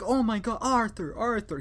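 high, frantic cries of his name; panicked pleading. Retold or imitated. Coworker watching the stabbing, high frantic pleading. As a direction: beg him to stop in a rising panic. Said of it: "He has an accent".

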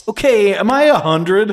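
loud high disbelief; incredulous. Retold or imitated. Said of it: "you're just like".

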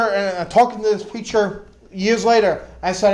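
usual speaking voice

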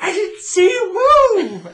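high, loud, slow laughing exclaim; mock astonished cry; amazed guest. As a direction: shriek it out in delighted shock. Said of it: "they'd be like".

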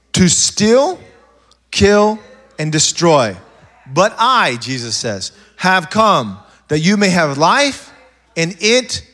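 loud, measured proclamation, key words drawn out; emphatic scripture reading. Acted. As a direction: declare the verse word by weighty word. Reads scripture aloud forcefully.

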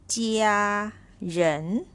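"家人" slowly, level 家 then rising 人; first then second tone. Shown as a demonstration. Teacher repeats word eight for learners, first then second tone. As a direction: separate "家" held level from "人" sweeping upward. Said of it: "First tone on top".

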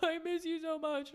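high, put-on weepy voice; mock crying. Telling late mom she misses her, mock crying. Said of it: "I'll definitely start crying and I'll be like".

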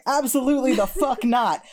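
high, forceful no way; emphatic refusal. Retold or imitated. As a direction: shut it down hard. Retold flat refusal to rich guy.

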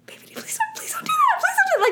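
very high, breathy pleading "please don't"; pleading with baby. Retold or imitated. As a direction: falsetto plea, hushed at first. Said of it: "I was like".

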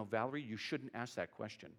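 low, soft mock admonishment; hushed reproof. Church member discouraging Valerie from asking questions, hushed mock reproof. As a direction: drop the voice as if discreetly correcting someone.

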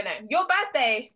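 ordinary delivery

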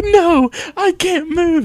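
hammed-up helpless whine; whiny Zenitsu. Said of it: "And he's just like".

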